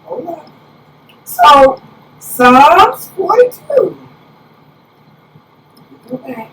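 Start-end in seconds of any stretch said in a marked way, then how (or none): 2.2-4.0 slow, drawn-out, louder announcement; announcing scripture. Real, not acted. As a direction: draw out the psalm number for the congregation.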